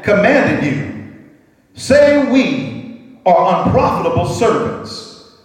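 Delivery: slow, drawn-out reading voice; slow scripture reading. Acted. Pastor reading Luke aloud, slow and drawn out. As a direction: lay out each word slowly for the church.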